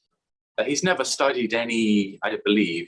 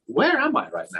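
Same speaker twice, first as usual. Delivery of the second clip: a much higher, amazed question; lost tourist's high, disbelieving cry; bewildered. Retold or imitated. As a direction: sound lost in a strange place. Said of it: "I'm like".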